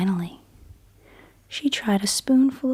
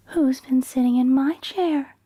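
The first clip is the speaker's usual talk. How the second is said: higher, louder, voiced Mama Bear; medium-sized Mama Bear voice; 6 semitones higher, 4 dB louder than usual. Mama Bear asking after her porridge, in a higher, louder voice. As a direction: step out of the whisper into a motherly voice. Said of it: "cried Mama Bear in her medium-sized voice".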